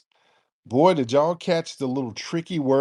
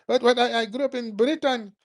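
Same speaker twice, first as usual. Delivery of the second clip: higher, mock defensive caller; 7 semitones higher than usual. Host imitates a caller denying her roots, higher mock voice. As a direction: squeak out her excuse, higher.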